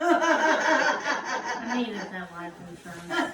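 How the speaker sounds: slow, loud joke pushed out through laughter; laughing at his own dark joke. Real, not acted. Brother joking about the insurance money, laughing loudly.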